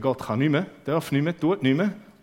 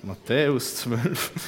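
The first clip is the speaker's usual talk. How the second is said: ordinary talk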